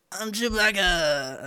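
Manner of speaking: mock "real" Chewbacca impression, slow and stretched; drawn-out put-on voice, slightly lifted; Ellie. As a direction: imitate a friend's keener Wookiee attempt. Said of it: "Here's Ellie's real impression".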